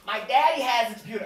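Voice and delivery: high, loud child imitation; little girl. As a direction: announce it like a proud kid. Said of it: "a little girl just gets right up".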